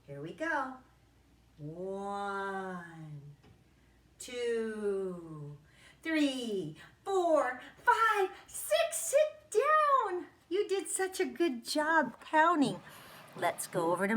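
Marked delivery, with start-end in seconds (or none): 1.6-8.9 slow then faster. Shown as a demonstration. Teacher speeds the count while the kids spin. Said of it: "start slow and then get faster". 8.9-12.8 excited. Real, not acted. Excited praise after spin.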